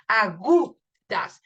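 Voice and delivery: "agudas" slowly, GU loud, "das" detached; teacher breaking "agudas" at the stress; stressed syllable. Shown as a demonstration. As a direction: punch the U in "agudas" and pause after it. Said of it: "el fonema que se acentúa".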